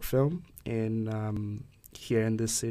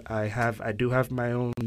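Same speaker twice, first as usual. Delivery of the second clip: usual speaking voice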